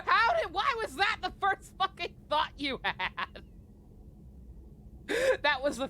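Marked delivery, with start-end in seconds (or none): 0.1-3.4 laughing disbelief. Real, not acted.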